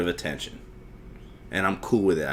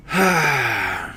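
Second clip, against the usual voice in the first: exasperated sigh. Real, not acted. Host giving up on fixing his phone, exasperated sigh. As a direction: let the frustration out in one breath.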